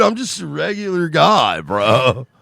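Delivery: high, loud mock protest; put-on regular guy. Voices the news anchor denying it.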